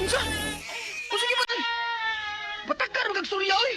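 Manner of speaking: high, loud furious retort; angry scolding. Acted. Parent scolding the wailing child, high angry shout. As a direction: snap back at the wailing child.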